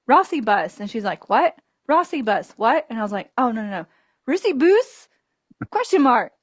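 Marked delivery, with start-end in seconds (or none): none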